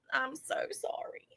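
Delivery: hushed, voiceless apology; whispered mock apology. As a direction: let the apology drop to a whisper. Mock tearful confession, apology repeated.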